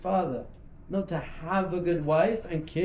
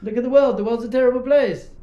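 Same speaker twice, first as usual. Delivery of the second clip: freaking out; 5 semitones higher, 9 dB louder than usual. Acted. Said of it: "Hashem must be freaking out up there".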